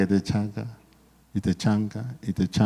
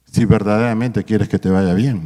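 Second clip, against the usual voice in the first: ordinary talk, nothing marked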